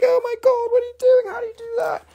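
high-pitched mock fan gasp; amazed fans. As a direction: gush like a starstruck audience member. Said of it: "And everybody's like".

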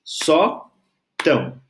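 "Sótão" split slowly into só, tão; slow, detached "Sótão", strong first syllable. Shown as a demonstration. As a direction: pause between the syllables of "Sótão". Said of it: "vou separar em sílaba".

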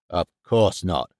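low, slow, restrained indignation; indignant denial. A narrator's reading. Father denying he approved the friendship, restrained indignation. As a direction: deny it firmly in a low voice. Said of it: "shouted Mister Norman".

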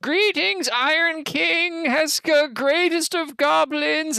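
high, slow, fawning goblin voice; a goblin kneeling before his king, high and slow; groveling goblin. Acted. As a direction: squeak it out like a bowing goblin servant. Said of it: "falls to one knee and starts".

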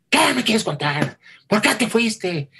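angry scolding. Retold or imitated. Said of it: "le puso una gritona".

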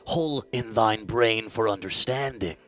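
solemn archaic prophet voice; mock prophet deity. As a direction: pronounce the prophecy like an oracle. Deity Lar speaks archaic prophecy.

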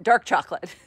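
usual speaking voice

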